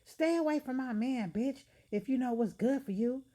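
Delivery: high, loud catfight yell; shrieking threat. Acted. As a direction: scream it at the rival. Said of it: "I yell".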